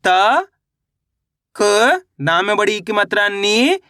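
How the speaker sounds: त and क each alone with pauses, नी held long and rising; letter-by-letter sounding out. Shown as a demonstration. Teacher leading learners to read along, slow letter-by-letter sounding out. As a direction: sound out त, क, then a stretched नी. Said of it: "आपको मेरे साथ साथ पढ़ना है".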